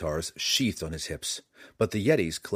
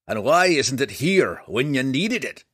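higher, louder gruff dwarf; gruff gravelly dwarf voice; 5 semitones higher, 7 dB louder than usual. A narrator's reading. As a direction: bark it like a cranky old dwarf. Dwarf Bruenor speaks.

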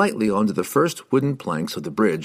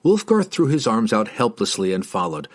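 ordinary delivery

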